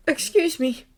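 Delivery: ordinary delivery, nothing marked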